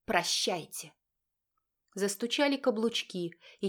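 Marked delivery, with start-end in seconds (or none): 0.1-0.9 squeezed-out goodbye with relish; smug, forced-out farewell; gloating farewell. A narrator's reading. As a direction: savour the parting word. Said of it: "с удовольствием выдавила".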